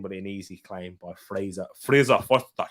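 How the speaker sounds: put-on Scottish-accented player name. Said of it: "saying it in a Scottish accent".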